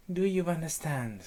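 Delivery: high helpless repeat; echoing nymph. Acted. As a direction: parrot it back in a high voice. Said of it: "you will repeat the last words".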